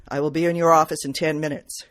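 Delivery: a hint of a cold, polished client; brusque but polished. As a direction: clip it out like an order. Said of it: "brusque but polished".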